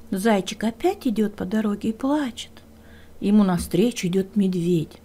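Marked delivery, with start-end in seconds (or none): none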